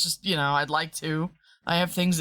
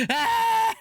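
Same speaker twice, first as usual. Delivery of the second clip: loud high shriek of fright; startled scream; 4 dB louder than usual. Real, not acted. Jumping at a game scare with a loud shriek.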